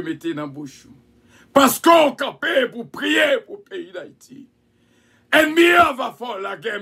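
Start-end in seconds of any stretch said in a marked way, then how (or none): 1.5-6.8 preaching. Acted. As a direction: call it out to the congregation. Preacher's emphatic outcry.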